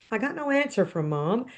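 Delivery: ordinary delivery, nothing marked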